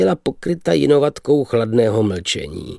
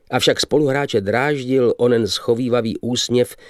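ordinary delivery

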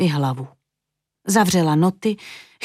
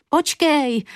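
calling out; 7 semitones higher than usual. A narrator's reading. Julinka calls in vain after fleeing Vitka, calling out. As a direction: call after someone getting away. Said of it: "volala marně Julinka".

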